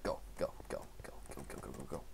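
very soft, whispered rapid repeats; whispered urging. Real, not acted. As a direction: urge it on under your breath. Urging on under the breath.

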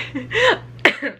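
high excited laugh; a burst of giddy laughter. Real, not acted. Overjoyed retelling.

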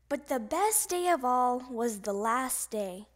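ordinary delivery, nothing marked

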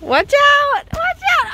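high urgent shout; shouting warning. Real, not acted. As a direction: yell out a sudden warning. Warning shout during snowball fight.

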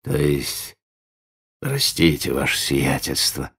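lightly voiced old man, low and halting; hesitant old man. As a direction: stall like a humble old organ-grinder. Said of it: "замялся Ладышкин".

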